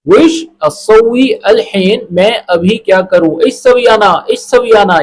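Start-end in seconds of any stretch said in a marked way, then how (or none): 0.1-2.0 slow, careful "وش اسوی الحین", each word apart; correct form. Shown as a demonstration. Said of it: "یہ ہے بالکل correct". 3.4-5.0 "اش سوی انا" run together, as learners say it; wrong form. Shown as a demonstration. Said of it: "یہ نہیں بولنا".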